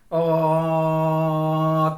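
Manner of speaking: one long, level-pitched vowel; steady drawn-out vowel sound; sustained vowel tone. Shown as a demonstration. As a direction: hold an open vowel steadily as a demo. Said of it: "tone, and all the language".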